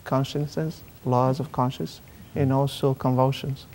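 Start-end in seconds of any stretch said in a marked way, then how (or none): none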